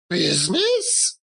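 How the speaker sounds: high, otherworldly exclamation; ghost's cry. A narrator's reading. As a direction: fling the word back as an anguished ghost. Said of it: "cried the ghost".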